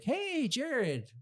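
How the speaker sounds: high-pitched, exaggerated excited hello; overexcited greeting. Teacher greets former student after the incident, overexcited imitation. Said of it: "not that excited".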